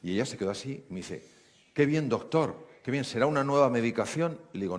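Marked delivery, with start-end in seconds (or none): 1.7-4.4 higher and louder, imitating eager delight; mock excitement at good news; patient eagerly hopes for a new medicine.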